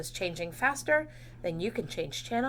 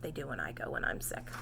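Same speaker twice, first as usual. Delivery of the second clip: lower, hushed confiding; whispered aside; 7 semitones lower, 11 dB quieter than usual. Real, not acted. Teacher confiding to the class in a low whisper.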